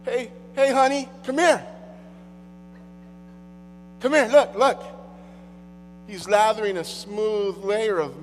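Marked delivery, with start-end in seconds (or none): 0.0-4.8 louder, higher calling to a partner; mock excited husband calling his wife to the TV; excited call to honey. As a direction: come look, honey. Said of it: "It's like".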